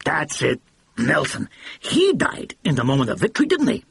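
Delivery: a blustering mogul catching his mistake; excited brash producer. A narrator's reading.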